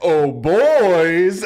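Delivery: loud sing-song call to the troops; calling the boys. Acted. The skeleton calls up his friends, loud and rising.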